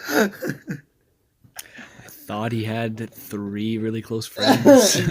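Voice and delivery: slow and loud, laughing through it; can't stop laughing. Real, not acted.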